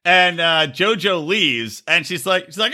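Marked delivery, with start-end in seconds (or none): none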